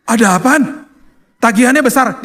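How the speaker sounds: high-pitched, mildly alarmed question; retold anxious questioning. Worried patient asks his wife about the hospital bill, anxious. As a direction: ask it with rising worry.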